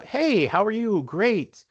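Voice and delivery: high, bright-eyed cheerful hello; overly chipper friendly greeting. Retold or imitated. Chatting up the stewardess, nervous but chirpy. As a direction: play the eager friendly passenger masking nerves. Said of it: "me being friendly Joe, nervous as all get up".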